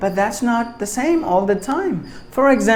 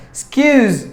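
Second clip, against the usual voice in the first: "Excuse" with the second syllable punched; stressed syllable; 6 semitones higher, 4 dB louder than usual. Shown as a demonstration. Teacher showing which syllable takes stress, "Excuse" stressed at the end. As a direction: put the volume on the scuse of "Excuse". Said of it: "should be given more volume".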